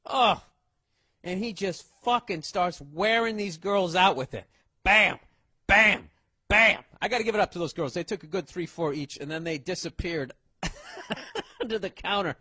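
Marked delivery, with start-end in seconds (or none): 4.8-6.8 shouted bam, bam, bam like pipe blows; mock sound effect of a pipe hitting; loud bam sound effects. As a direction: punch each bam out loud like a cartoon hit. Imitating pipe hits.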